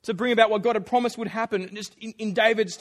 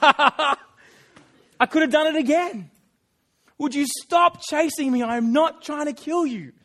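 loud, higher call with a laugh; 6 semitones higher, 7 dB louder, slower than usual. Retold or imitated. David calls over the valley to Saul, loud. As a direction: shout it across the valley, half laughing. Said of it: "And he's like".

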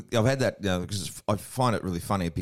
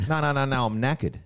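insistent correction; 9 semitones higher, 3 dB louder than usual. Acted. Acting himself correcting a confused friend.

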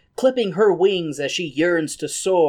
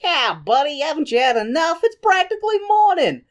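mock bartender; 11 semitones higher, 3 dB louder than usual. Bartender voiced again.

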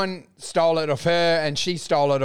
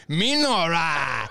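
mock broken English; 3 semitones lower, slower than usual. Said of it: "And I'm like".

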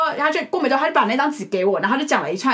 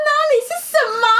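high fast confusion; 14 semitones higher, much faster than usual. Retold or imitated. Panicked confusion retold.

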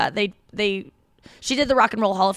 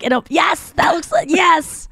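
high, shouted yes, yes; excited girl mole; 9 semitones higher, 4 dB louder than usual. Acted. Host voicing the girl mole, high and shouting yes. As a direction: squeal it like a thrilled little mole. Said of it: "the girl is like".

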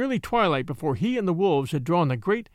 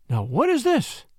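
higher-pitched puzzled question; bewildered; 6 semitones higher than usual. A narrator's reading. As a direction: ask it in mild bewilderment.

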